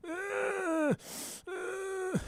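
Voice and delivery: high sharp gasping sobs, retold; hammed-up sobbing gasps. Boy crying into a stranger's lap, gasping sobs. Deep breaths while crying into lady.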